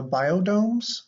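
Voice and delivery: ordinary talk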